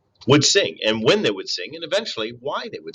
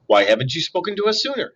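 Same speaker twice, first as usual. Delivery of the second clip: a touch higher, mild screech; screeching owl; 6 semitones higher than usual. A narrator's reading. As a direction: demand it like an upset owl. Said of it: "screeched the owl".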